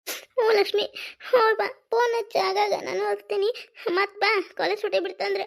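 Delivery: high, sobbing goodbye; crying farewell. Acted. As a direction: send them off while crying. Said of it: "ಯಾಕ್ ಅಳ್ತೀರಿ ಕಮಲ". (ಅಳುತ್ತಾ ಬೀಳ್ಕೊಡುಗೆ)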